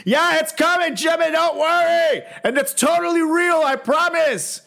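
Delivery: high, bright fake promise; mock reassuring. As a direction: sell the lie cheerfully.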